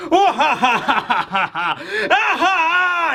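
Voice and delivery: hearty mock laugh, swinging high; acted laughing. Garp on the ground laughing while freezing, hearty mock laugh. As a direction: laugh it off like a man who refuses to fall. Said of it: "he's still laughing".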